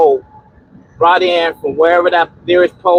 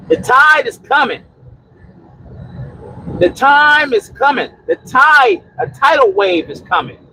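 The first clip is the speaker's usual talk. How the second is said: higher, solemn prophetic warning; preaching warning; 6 semitones higher than usual. Acted. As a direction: proclaim it like a prophet of the flood.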